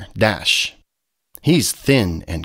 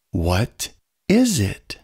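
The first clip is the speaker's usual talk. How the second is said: ordinary delivery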